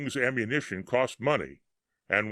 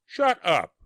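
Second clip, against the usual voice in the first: much higher and louder snap; bellowed; 8 semitones higher, 5 dB louder than usual. A narrator's reading. Soldier taking aim and bellowing at his mate to shut up. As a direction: cut him off with a bellow. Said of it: "the other soldier bellowed".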